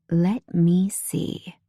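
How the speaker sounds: slow breathy whisper; seductive purr. Acted. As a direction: whisper it slowly, teasing. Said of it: "She purrs seductively".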